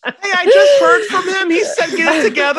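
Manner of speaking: high laughing voice. Real, not acted. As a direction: laugh through the words. Laughing joke about Jesus.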